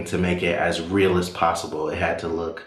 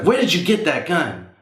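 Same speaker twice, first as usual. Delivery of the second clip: much higher, sharp demanding question; stern mom; 10 semitones higher than usual. Retold or imitated. Mom confronting her son about the gun, stern and alarmed. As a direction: demand an answer from the boy.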